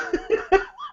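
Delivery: high, soft trailing laugh; helpless laughter. Real, not acted. Co-host cracking up over the name joke, helpless laughter. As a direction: laugh on after words give out.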